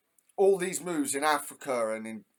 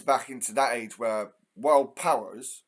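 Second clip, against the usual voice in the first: usual speaking voice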